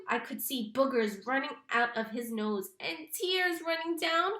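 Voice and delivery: plain reading voice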